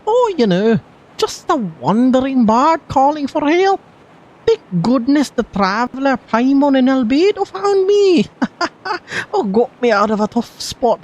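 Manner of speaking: high, lilting bard voice with giggles; playful bard. Acted. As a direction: play a carefree bard laughing off trouble, high. Voices a wandering bard game character.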